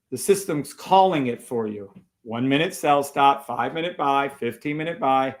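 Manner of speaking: normal talking voice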